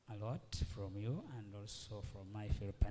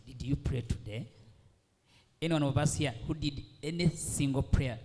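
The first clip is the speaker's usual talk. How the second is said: preaching; 3 semitones higher, 8 dB louder than usual. Real, not acted. Preacher challenging the room.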